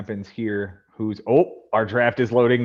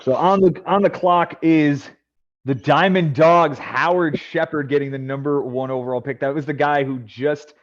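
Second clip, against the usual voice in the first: draft announcer; 5 semitones higher, 4 dB louder than usual. Acted. Host calling the number one overall pick, announcer style. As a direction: present the first pick like a big event.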